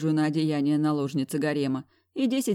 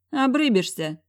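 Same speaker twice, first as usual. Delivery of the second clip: sassy retort; 7 semitones higher, 5 dB louder than usual. Acted. Heroine snaps back.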